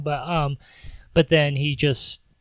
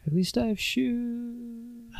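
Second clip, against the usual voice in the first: high, slow, wry consolation, lightly put on; mock hero's consolation; 9 semitones higher, 6 dB quieter, much slower than usual. Barefoot hero cheering himself up, high and slow in mock self-pity. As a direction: drag it out as a self-pitying joke.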